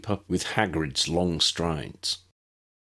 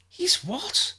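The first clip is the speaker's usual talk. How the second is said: very high astonished squeak; child stunned by news; shocked gasp. A narrator's reading. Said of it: "Lydia gasped".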